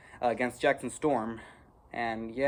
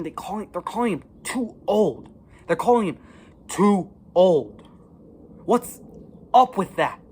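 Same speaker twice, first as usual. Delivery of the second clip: rising, louder outraged complaint; angry rant; 5 semitones higher, 6 dB louder than usual. Real, not acted. Kid ranting about Cars three, furious. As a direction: vent real fury at the unfair plot. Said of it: "freaking just makes me angry".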